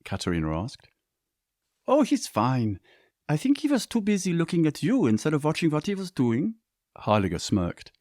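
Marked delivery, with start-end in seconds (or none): 1.9-6.5 lightly voiced teasing mother; a hint of a playful, higher mother. A narrator's reading. Mother teases, voiced character.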